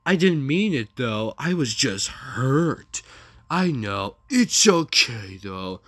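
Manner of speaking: slow, exaggerated lovey-dovey quote; mocking lovers' texts. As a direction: ham up the hurt apology.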